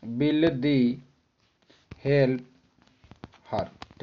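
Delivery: words dropped one by one with long pauses; spaced-out answer. Shown as a demonstration. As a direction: dictate the answer word by word. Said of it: "सही answer हो जाएगा will they". (शब्द-शब्द रुक-रुक कर)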